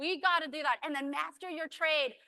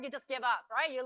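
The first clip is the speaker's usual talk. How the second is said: usual speaking voice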